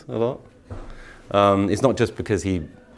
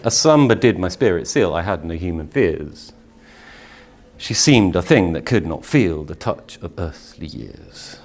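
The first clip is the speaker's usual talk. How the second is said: poetry recitation; 3 semitones lower than usual. Shown as a demonstration. Teacher rereading Wordsworth to show ballad rhyme. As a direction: let the lines settle.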